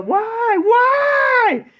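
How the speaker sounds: high, wailing mock scream; screamed lament, very high and drawn out; Esau's anguished scream. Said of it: "that's how it is with Esau".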